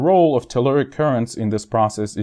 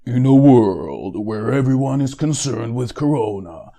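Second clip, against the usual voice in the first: movie trailer voice. Acted. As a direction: announce it like a Hollywood trailer. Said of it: "Hollywood trailers where, uh, you have something like".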